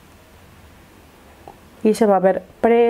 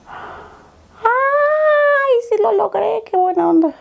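long, very high delighted squeal; emoción triunfal; 13 semitones higher, much slower than usual. Real, not acted. Celebra que sí lo logró. (chillido agudo de emoción)

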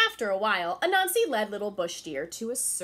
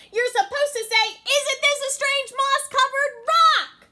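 much higher, loud strained yell; exasperated Anansi; 13 dB louder than usual. Acted. Anansi yelling the magic words at the deer, exasperated. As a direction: blurt it out in frustration.

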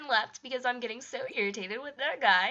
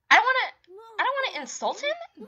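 worked-up outburst; 11 semitones higher, 7 dB louder, faster than usual. Real, not acted. Deciding how to react to Trenton.

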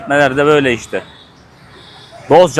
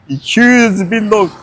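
slow mock of the seller's accent, higher; imitating the seller; 5 semitones higher, 4 dB louder, slower than usual. Mocking the vendor to viewers, imitating her. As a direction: parrot the vendor's price slowly. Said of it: "Abla iki yüz bin dedi ya".

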